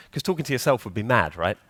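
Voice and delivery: ordinary delivery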